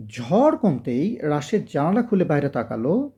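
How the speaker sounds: usual speaking voice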